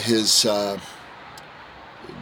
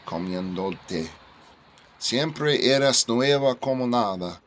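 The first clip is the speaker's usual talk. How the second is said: reading in Spanish; 3 semitones higher than usual. Shown as a demonstration. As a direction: recite the ode in Spanish with swelling fervor. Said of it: "read this in Spanish".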